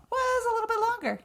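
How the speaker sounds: high-pitched, quick understatement; coy understatement. Real, not acted. Host hinting how long the recording ran, coy understatement.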